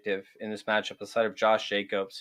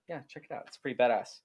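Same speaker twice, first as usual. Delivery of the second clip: ordinary talk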